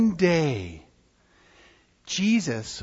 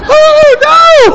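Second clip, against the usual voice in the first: hammed-up cry of alarm, much higher and louder; mock panic; 16 dB louder than usual. Storyteller voicing the scared disciples for children, hammed-up wail. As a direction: wail it like a frightened sailor.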